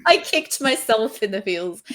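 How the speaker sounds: laughing through the words. Real, not acted. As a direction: chuckle while you say it. Laughing at her own scene.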